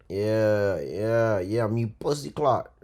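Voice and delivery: badly faked Jamaican accent; lightly mock a Jamaican accent; hammed-up Jamaican accent, barely done. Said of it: "that was a horrible, horrible accent".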